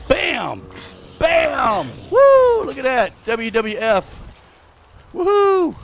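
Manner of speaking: high, loud whooping hits; playful battle cries. Pretend. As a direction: whoop it up like a wrestling fan. Play fight with game mob.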